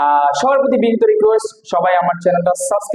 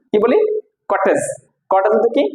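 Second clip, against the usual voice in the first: ordinary delivery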